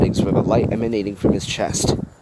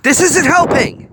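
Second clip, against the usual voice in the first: loud shout; 8 dB louder, slower than usual. A narrator's reading. Wasabi separating the women.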